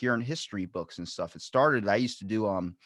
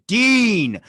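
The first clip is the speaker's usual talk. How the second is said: loud, high excited shout of the name; hyped-up introduction; enthusiastic intro; 11 semitones higher, 11 dB louder than usual. Shown as a demonstration. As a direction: announce him like a star. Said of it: "Let's do it all over again and say".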